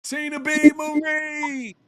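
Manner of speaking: loud, high announcer holler; calling out. Real, not acted. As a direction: call the guest in with a drawn-out name. Calls the guest in.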